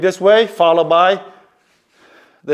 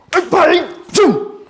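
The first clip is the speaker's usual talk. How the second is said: strike sounds; 12 semitones higher than usual. Shown as a demonstration. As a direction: punch out the sound effects as you strike. Full-speed strike demo.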